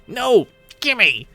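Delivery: high, loud grabby cry; squabbling yell. Acted. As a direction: yelp it while tussling over something. Acted grabbing fight over a ring.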